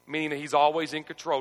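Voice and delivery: ordinary talk, nothing marked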